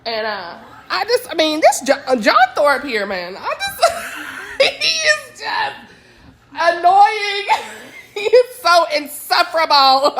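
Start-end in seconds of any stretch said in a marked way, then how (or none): 3.1-10.1 higher, laughing through the words; can't stop laughing at him; real laughter while complaining; laughing exasperation. Real, not acted.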